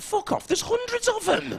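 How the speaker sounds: put-on high, loud and slow drunk protest; indignant shout. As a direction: yell it back at the radio. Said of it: "And I went".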